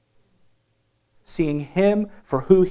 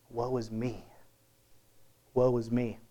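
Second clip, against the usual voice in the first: softer, lower, awed murmur of woe; hushed woe; 4 semitones lower, 10 dB quieter than usual. Retold or imitated. Preacher voicing Isaiah shaken by God, hushed and low. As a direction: breathe it out, overwhelmed and small.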